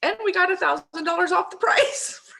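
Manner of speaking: high, giddy, laughing through the words; real laughter spilling into speech; laughing in delight. Real, not acted. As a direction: crack up while telling of the bargain. Laughs over the bargain she got.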